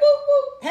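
high wailing siren imitation; bullhorn with siren. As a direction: wail like a police siren.

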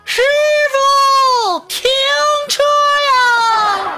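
high desperate shout, syllables stretched; yelling after taxi. Retold or imitated. Chasing the taxi in the rain, shouting at the driver to stop. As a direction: shout it across the street in the rain. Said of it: "狂奔着追着那个出租车喊".

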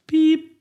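high squeaky spoken bleep. Shown as a demonstration. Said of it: "mach immer Piep". (hohes gesprochenes Piep)

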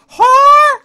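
sudden high, loud mock scream; screamed insult. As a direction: screech it out stupidly, way over the top. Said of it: "y'all just be screaming out stupid".

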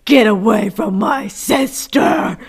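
slow, loud battle cry, drawn out; fierce dramatic shout. Retold or imitated. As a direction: act out a protective roar. Acting sister's attack on the king.

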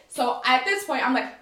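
usual speaking voice

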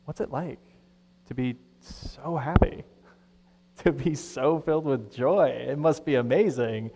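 a lightly voiced, starry-eyed question; gently mock the dreamy envy; mock wonder. Poorer person asking the richer one: mock wonder. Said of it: "goes to the person ... and says".

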